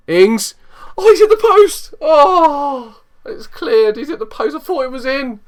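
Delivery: high, loud cry of disbelief; near miss. Real, not acted. Gamer crying out as his striker hits the post. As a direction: yell as the ball hits the post.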